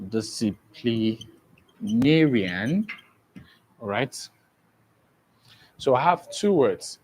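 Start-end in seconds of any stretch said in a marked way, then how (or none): 0.1-2.9 DISCIPLINARIAN very slow, broken into syllables; teacher sounding out disciplinarian slowly in pieces. Shown as a demonstration. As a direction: stretch out disciplinarian, pausing between its syllables, rising at the end. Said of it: "let's make a syllabic division".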